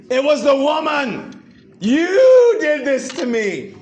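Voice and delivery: hammed-up husband shoving the blame onto his wife; Adam blaming. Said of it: "What did, what did Adam do?".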